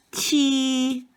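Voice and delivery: 七 held high and level, first tone. Shown as a demonstration. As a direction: keep 七 high and flat. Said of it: "it's accent number one".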